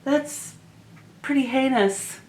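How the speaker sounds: high mock disgust, then trailing off. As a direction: act out a horrified reaction. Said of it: "I thought".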